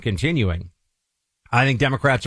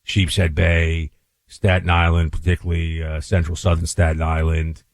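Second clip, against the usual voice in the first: a tough New York accent warning Clay about Staten Island; 5 semitones lower than usual. Shown as a demonstration. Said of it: "I was switching on purpose".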